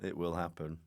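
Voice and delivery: ordinary delivery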